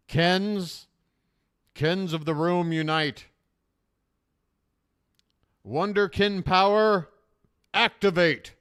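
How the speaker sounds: loud, slow war cry rallying troops; rallying battle cry. Acted. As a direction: rouse the troops before battle.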